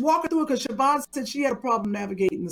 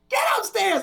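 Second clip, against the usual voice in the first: sharp high shout; mom yelling; 10 semitones higher than usual. Retold or imitated. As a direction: bark the order upstairs. Mother yelling at teen.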